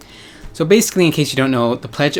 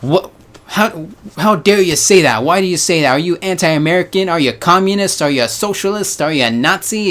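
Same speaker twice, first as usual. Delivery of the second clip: higher-pitched mock indignation; outraged accusation; 6 semitones higher than usual. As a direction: fire off scandalized accusations, pitched up. Imagined outraged people challenge critic.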